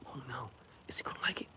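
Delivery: fast anxious whisper; worried servers whispering among themselves. Acted. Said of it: "the servers are all anxious".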